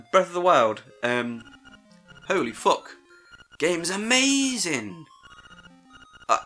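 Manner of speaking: normal talking voice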